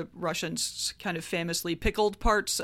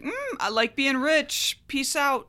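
smug rich duke; 6 semitones higher than usual. Retold or imitated. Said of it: "being like".

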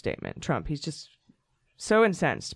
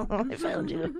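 creepy sing-song; 13 semitones higher, 5 dB quieter than usual. Pretend. Playful creepy discovery.

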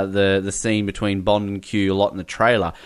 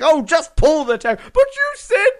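much higher, louder squeak; high squeaky voice; 6 dB louder than usual. Retold or imitated. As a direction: squeal like a flustered underling. Voicing R and Q exchange.

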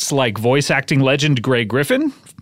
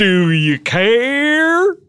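slow high drawn-out; 7 semitones higher, much slower than usual. Pretend. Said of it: "repeated, higher and louder".

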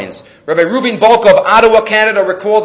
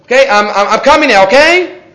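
higher, louder, sing-song asking leave; mock polite German; 6 semitones higher, 6 dB louder than usual. Imitating Germans who always asked permission. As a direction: ask permission overly politely. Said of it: "They always said it like that".

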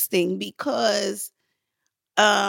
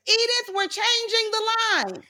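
high, carrying holler; imitated director's call; 12 semitones higher than usual. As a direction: call across the set, pitched way up. Director calling out across set.

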